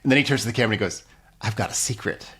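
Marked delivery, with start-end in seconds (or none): 1.4-2.3 softer, slower, sly confiding; naughty secret. Retold or imitated. Buck turns to the camera, slyly confiding a secret. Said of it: "It's so naughty about it".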